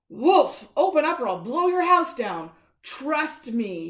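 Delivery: loud booming wolf demand; wolf threat. Acted. Wolf yelling at the pig's door, loud threat. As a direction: bellow it like a big bad wolf.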